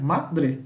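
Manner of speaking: Madre split as MA, then DRE; two separate sounds. Shown as a demonstration. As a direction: give Madre two distinct beats. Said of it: "São dois sons".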